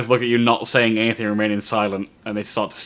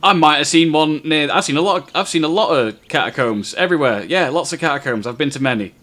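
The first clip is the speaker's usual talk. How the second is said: flustered rambling guard; 5 semitones higher, 5 dB louder than usual. Acted. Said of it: "unable to stop himself from speaking, says".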